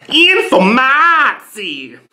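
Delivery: "Informatie" loud, high, A stretched out; honked Dutch "Informatie", slow and high; triumphant mock Dutch. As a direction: unveil "Informatie" proudly with a blaring long "maaa". Farmer unveils the new Dutch word.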